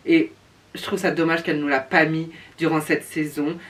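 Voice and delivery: ordinary delivery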